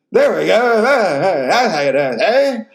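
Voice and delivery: high, loud, laughing brag; laughing triumph. Retold or imitated. Boasting after retold joke lands.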